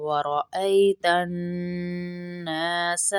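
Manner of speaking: الناس with the alif held one count; slow ورأيت الناس, alif drawn out; stretched alif. Shown as a demonstration. Teacher models the stretched alif in الناس. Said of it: "ایک الف کے برابر کھینچے".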